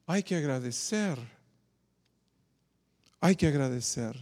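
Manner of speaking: very soft, low voice; hushed realisation. Retold or imitated. The one leper turning back to give thanks, hushed. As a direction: say it quietly, as if to oneself.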